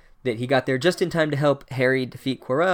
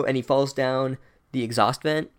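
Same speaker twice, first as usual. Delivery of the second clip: normal talking voice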